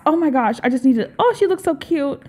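ordinary delivery

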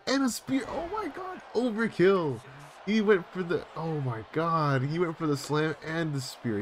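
high, amazed play-by-play outburst; excited disbelief. Real, not acted. Viewer reacting to slam and spear overkill, excited disbelief. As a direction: pitch soaring in astonishment.

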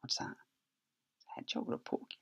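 soft unvoiced guess; whisper. Real, not acted. Guessing the animal to himself.

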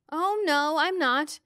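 plain reading voice

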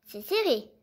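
ordinary delivery

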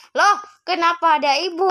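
a sudden loud jolt of surprise; startled. Acted. Mother suddenly appears. (kaget melihat ibu muncul)

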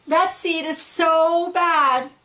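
high, slow disapproving voice; tut-tut like judging neighbours; gossiping seeds. Acted. Other seeds gossip about him.

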